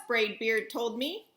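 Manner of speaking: ordinary delivery, nothing marked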